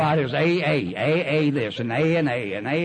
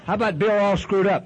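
raised, pushy mock questioning; higher, louder teasing interrogation; mocking sponsor voice; 6 semitones higher, 4 dB louder than usual. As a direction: needle him like a sponsor testing him. Mock sponsor quizzing him.

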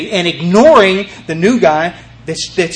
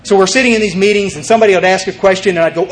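ordinary delivery

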